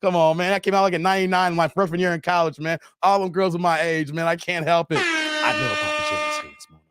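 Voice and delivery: ordinary talk, nothing marked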